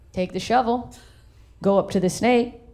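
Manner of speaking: normal talking voice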